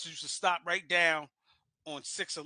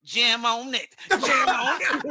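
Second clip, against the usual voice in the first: loud laughing mock bear voice; hammed-up bear singing the song; voicing the bear; 5 semitones higher, 10 dB louder, much slower than usual. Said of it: "the bear looked at me and said".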